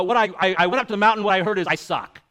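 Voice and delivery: deadpan joke in a loud everyday voice; mock vernacular. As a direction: congregant summing up the sermon: loud jokey complaint. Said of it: "in the vernacular would say".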